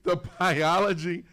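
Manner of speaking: loud incredulous question; can't believe what he heard; amazed disbelief. Real, not acted. Host amazed by caller.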